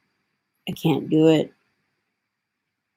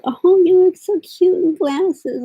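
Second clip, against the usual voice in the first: high, slow gushing compliment; mock cooing friends; 14 semitones higher, 4 dB louder than usual. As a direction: coo it like a sweet fan. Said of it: "and everyone says".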